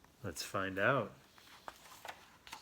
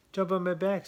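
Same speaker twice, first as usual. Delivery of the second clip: high, louder coax; coaxing buzzard; 8 semitones higher, 7 dB louder than usual. A narrator's reading. Buzzard urges squirrel onto his back, high coax. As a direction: urge the squirrel up, voice raised.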